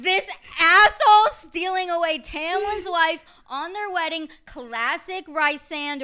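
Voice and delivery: half-laughing, put-on fury; mock outrage. Wedding guests fuming at Rysand, loud and hammed-up. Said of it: "Everyone's like".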